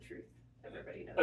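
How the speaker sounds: quick, breathy slogan voice; t-shirt slogan. Pretend. Reading a made-up t-shirt slogan, mock slogan. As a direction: rattle it off like a catchy motto. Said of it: "one of them will say".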